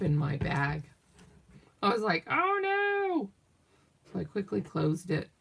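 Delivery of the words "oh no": very high, wailed mock alarm; playful high squeal of dismay; retold dismay. Crafter finding glue dripping in her bag, mock dismay.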